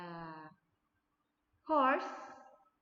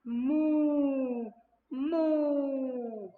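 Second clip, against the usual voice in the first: loud, drawn-out mooing cow; 4 semitones higher, 9 dB louder than usual. Shown as a demonstration. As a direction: moo like a cow. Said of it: "A cow says".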